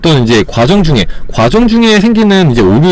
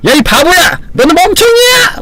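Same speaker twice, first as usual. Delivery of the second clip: shrill, much higher mock name-calling; a cartoonish put-down, pitched way up; mock insult. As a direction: sneer it like a childish bully. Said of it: "대놓고 부정적인 말".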